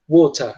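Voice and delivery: "water" with a crisp real T and no final R; British "water", hard T, ending on a vowel; British true T. Shown as a demonstration. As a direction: say "water" British-style, T fully sounded. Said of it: "como se fosse um T mesmo".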